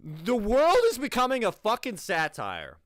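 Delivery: exasperated yell at the screen; exasperated outburst. Real, not acted. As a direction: blurt it out in disbelief. Ranting at absurd video.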